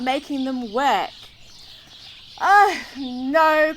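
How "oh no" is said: dismayed father, louder; a father's groaning oh no; father dismayed at the ranch, crying oh no. A narrator's reading. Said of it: "cried Will's father".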